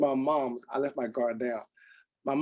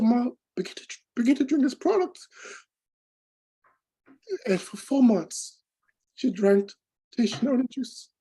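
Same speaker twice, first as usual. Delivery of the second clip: much higher, tearful voice with long halting pauses; real teary emotion; 7 semitones higher, 3 dB louder than usual. Real, not acted. Son telling how his mom began drinking the juice, very emotional. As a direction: push the story out through tears. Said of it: "I get very emotional".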